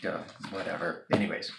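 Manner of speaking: usual speaking voice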